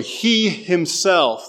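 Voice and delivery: "He" drawn out slow and leaned on hard; emphatic he. Shown as a demonstration. Preacher reading Peter, stressing "He" slowly. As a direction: stress "He", as if he and no other. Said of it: "there's a kind of an emphatic he".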